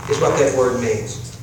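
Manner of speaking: usual speaking voice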